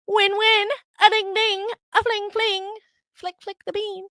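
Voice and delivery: high, sing-song chime; playful high-pitched chant; silly jingle. Acted. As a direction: ring it out like a prize bell. Silly celebratory rhyming aside.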